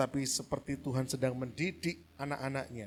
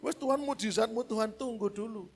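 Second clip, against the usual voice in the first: mock complaining husband; 9 semitones higher, 5 dB louder than usual.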